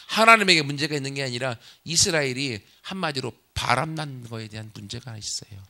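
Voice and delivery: ordinary talk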